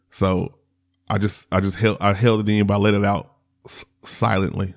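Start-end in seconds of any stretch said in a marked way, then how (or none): none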